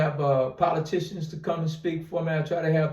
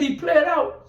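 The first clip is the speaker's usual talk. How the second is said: high-pitched stunned question, retold; reliving the moment the news hit, in disbelief; retold shock; 10 semitones higher, 3 dB louder, slower than usual. Hearing shocking news on phone.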